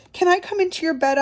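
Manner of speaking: high, fast pleading voice; quick high plea from a small mouse; little mouse asking. A narrator's reading. Little Mouse begging to share the bed, high plea. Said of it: "asked Little Mouse".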